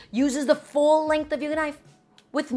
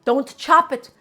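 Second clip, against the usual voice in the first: emphatic warning; 3 semitones higher, 7 dB louder than usual. Real, not acted. Chef scolds bad knife technique.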